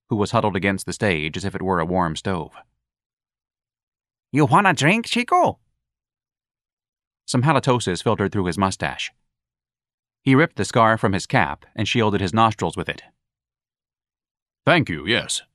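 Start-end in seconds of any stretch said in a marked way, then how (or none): 4.3-5.6 high, sly barmaid voice; leering barmaid. Acted. Barmaid leers at Ignatius. 14.6-15.4 muffled through a scarf. Acted. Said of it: "he said in a muffled voice".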